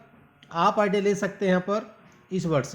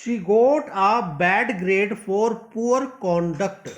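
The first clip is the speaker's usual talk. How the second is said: very slow reading, conduct stressed on con; slow correct reading; much slower than usual. Shown as a demonstration. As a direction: spell it out slowly and correctly. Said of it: "एक बार फिर से बता रहा हूँ". (धीमे, सही पढ़ना)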